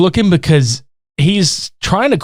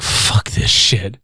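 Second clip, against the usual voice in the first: muttered slow; 8 semitones lower, much slower than usual. Retold or imitated. Key grip mutter.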